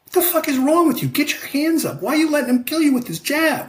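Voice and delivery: high-pitched frantic yelling at her fighter; wife yelling from the corner. Retold or imitated. Wife in the corner yelling at her husband sparring, high frantic scolding. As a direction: scold him like a cornerwoman losing her patience. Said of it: "and she'll be like".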